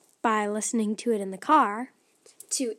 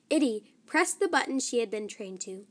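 usual speaking voice

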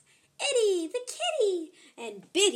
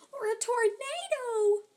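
panicky; 9 semitones higher, slower than usual. Acted. Itty panicking about disasters.

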